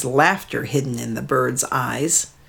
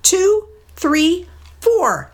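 much higher bird voice, counting; mud hen; 14 semitones higher than usual. Acted. Mud hen counting the brothers, high bird voice. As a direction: voice a small eager bird. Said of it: "he heard a mud hen say".

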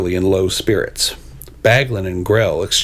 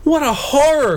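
high-pitched theatrical horror; hammed-up high wail of horror; horrified cry. A narrator's reading. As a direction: cry out in storybook dismay. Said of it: "cried the Yellow Knight".

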